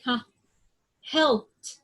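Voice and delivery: ordinary delivery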